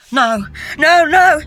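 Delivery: high, loud cry of no; a panicked, pained no, no, no; anguished cry. A narrator's reading. Matt crying out to Cecile in anguish. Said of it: "he cried".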